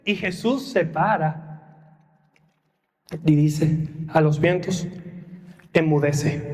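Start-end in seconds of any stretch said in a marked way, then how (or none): 5.7-6.5 lower, firm rebuke; Jesus commanding the winds, low and firm; stern command. Acted.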